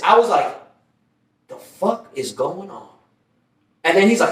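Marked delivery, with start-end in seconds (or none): 1.5-2.9 slow, soft, confused self-quote; dazed bewilderment. Retold or imitated. Waking in a cellmate's arms after a seizure, dazed and quiet. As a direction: draw it out quietly, as if coming round.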